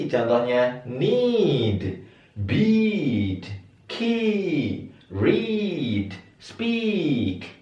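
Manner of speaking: each word stretched long and pitched high; long high vowel. Shown as a demonstration. Teacher modelling long vowels slowly and high. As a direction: draw out the long ee in "need", "beat", "key", "read", "speak". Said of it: "harus dibaca tinggi".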